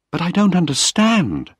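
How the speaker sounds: Penny crying out after reading her father's letter. Acted. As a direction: pitch it up in upset disbelief. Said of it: "she cried".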